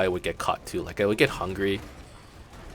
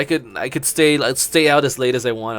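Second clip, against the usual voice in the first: put-on giddy kid running away, a bit higher and louder; excited kid; 4 semitones higher, 6 dB louder than usual. Kid boasting to himself about running away, giddy. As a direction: crow about freedom like a giddy runaway. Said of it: "I'm all excited, I'm all giddy".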